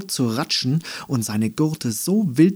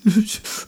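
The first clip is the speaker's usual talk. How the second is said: high mocking giggle; 10 semitones higher than usual. Flowers laughing.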